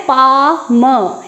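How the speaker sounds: पाम drawn out very slowly; very slow, careful पाम; slow model word. Shown as a demonstration. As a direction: stretch पाम out slowly, silent L. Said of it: "क्या बोलना है".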